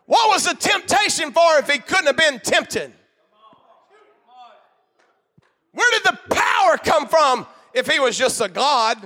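climbing pulpit shout; preaching shout. Real, not acted. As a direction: hammer the questions home like a preacher.

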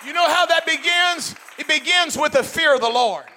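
loud, high preacher's shout; full-force preaching. Acted. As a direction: preach it out to the whole congregation.

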